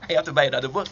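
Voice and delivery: loud, playful exclamation; mock exclamation. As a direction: call it out loudly in mock complaint. Exclaims, then repeats it altered.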